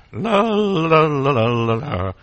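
slow drawn-out lalala; babbling la-la. Shown as a demonstration. Preacher showing listeners how to babble, slow. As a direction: babble it out like a small child. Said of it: "die Kindersprache ist lallen".

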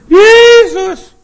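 sudden high, loud shout; calling out. Shown as a demonstration. Preacher showing what he calls all day, loud shout. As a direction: cry the name out loud. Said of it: "ich rufe immer wieder".

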